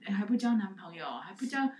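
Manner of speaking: mocking relatives pestering about boyfriends; mock nagging. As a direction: pile on like nagging in-laws.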